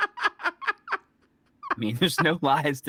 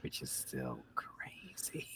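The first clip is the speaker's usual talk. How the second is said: whispered, much softer and slow; muttered aside; 9 semitones lower, 19 dB quieter, slower than usual. Real, not acted. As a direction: mutter it under your breath. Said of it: "What'd you say?".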